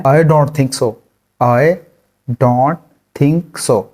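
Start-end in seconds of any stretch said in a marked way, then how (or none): 1.4-3.8 broken into single words with pauses. Shown as a demonstration. Teacher splits the phrase into words for the class. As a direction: pause after every word. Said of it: "इसको English में कहते हैं".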